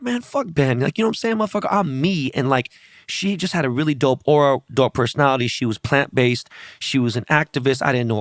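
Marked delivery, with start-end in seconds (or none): 0.0-2.3 higher-pitched imitation of a brash woman; brash retold quote. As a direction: mimic her brash self-confidence up high. Acting out sassy girl's quote.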